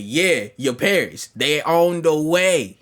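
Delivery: loud, drawn-out warning. Retold or imitated. Warning Hope her parents are coming, loud and drawn out.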